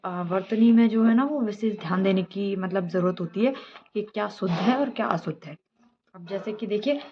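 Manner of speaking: ordinary delivery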